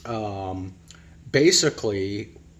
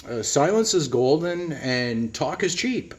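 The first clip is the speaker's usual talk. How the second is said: ordinary delivery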